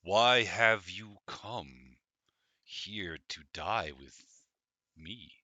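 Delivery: slow, menacing dragon threat. Acted. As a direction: draw it out like a dragon taunting prey. GM voices the dragon.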